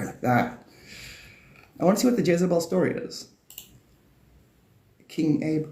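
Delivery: normal talking voice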